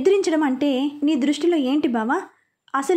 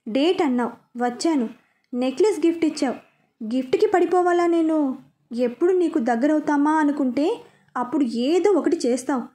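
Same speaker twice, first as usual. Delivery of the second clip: ordinary delivery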